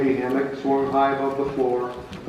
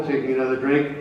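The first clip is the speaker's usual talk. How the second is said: ordinary delivery, nothing marked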